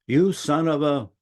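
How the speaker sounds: lightly acted rumbly grumble, stretched out. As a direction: imitate a rumbly-voiced guy, slowly. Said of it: "rumbly voice".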